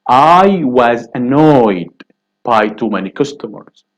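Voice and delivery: very slow on I was annoyed; slow model answer. Shown as a demonstration. Teacher modelling the passive answer slowly. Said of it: "which is I".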